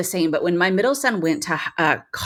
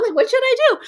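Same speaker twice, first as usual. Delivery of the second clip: frantic mom; 3 dB louder, faster than usual. Retold or imitated. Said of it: "as a mom, I'd be like".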